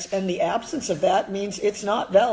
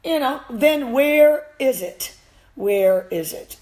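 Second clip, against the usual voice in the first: slow, pointed stress on where; demanding emphasis; 5 semitones higher, 5 dB louder, slower than usual. Real, not acted. To rival trainers, demanding proof, slow and insistent. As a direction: lean hard on "where" both times, slowly. Challenging critics, repeated demand.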